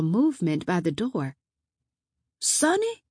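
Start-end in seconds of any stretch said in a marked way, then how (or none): 2.4-3.0 high questioning call, feigning confusion; put-on puzzled question; fake confused. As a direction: call it out as if puzzled, pitch lifting at the end. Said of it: "recognized the fake, confused voice".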